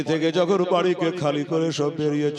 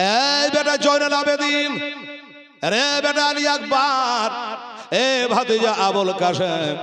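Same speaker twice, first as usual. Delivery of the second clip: calling out; 10 semitones higher than usual. Acted. Father calling sons to prayer.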